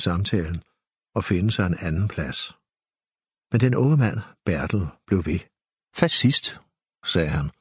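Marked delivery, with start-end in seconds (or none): 6.0-6.5 condescending. A narrator's reading. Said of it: "cementerede den nedladende titulering".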